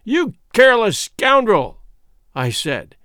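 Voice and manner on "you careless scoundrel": high, loud rebuke; indignant scolding. A narrator's reading. Quatermain rebukes Jim for losing the note, indignant scolding. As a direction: scold him with raised voice.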